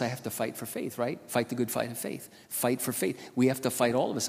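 ordinary talk, nothing marked

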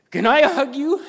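high, loud imitation of an overcome man; emotional plea. Moved store worker asks pastor for a hug, acted emotion.

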